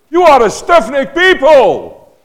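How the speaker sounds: high, loud, slow furious shout; angry rebuke. Shown as a demonstration. Preacher demonstrating his angry face to the church, furious shout. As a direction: bellow it like a wrathful prophet. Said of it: "with a really angry face".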